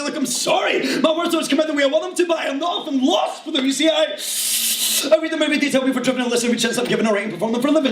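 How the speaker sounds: urgent poetry recital, easing down at the close; performance poem. Acted. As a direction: race and stretch the tongue twisters as slam verse. Performs slam poem full of tongue twisters.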